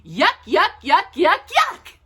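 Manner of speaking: high, climbing chain of yucks; playful disgust. A narrator's reading. Storyteller playing up disgust for a giggling child, high and rising.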